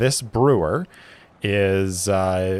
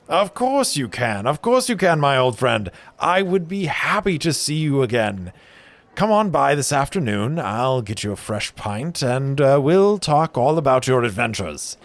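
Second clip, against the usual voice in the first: hearty brewer; 6 semitones higher than usual. Acted. Brewer inviting his friend for a pint, high and hearty. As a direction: welcome him over warmly.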